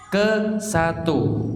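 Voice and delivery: ke satu spoken slowly, each word stressed; teacher slowly stresses "ke" in "Ke satu.". Shown as a demonstration. Ustaz slowly stresses "ke satu" for his students. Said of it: "al-awwalu artinya ke satu".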